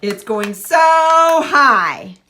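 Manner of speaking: slow, stretched and loud, smooth co-articulation; slow modeled phrase. Shown as a demonstration. Speech therapist modeling slowly for a young client. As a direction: draw the phrase out slowly for a child to copy. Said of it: "Tell me one more time".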